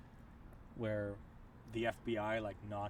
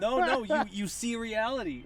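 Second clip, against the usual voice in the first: excited; 10 semitones higher, 9 dB louder than usual. Real, not acted. Excited retort after friend laughs.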